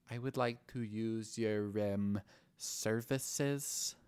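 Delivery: drawn out with halting pauses; awkward, weird request. Retold or imitated. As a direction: stretch every word, hesitating before the key one. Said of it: "he says it like that".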